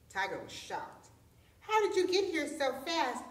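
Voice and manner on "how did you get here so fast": mild, higher-pitched cry of disbelief; mock scream. A narrator's reading. Shocked tiger questions the tortoise, in a mild storytelling scream. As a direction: exclaim it high, short of a real scream. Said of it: "he screamed".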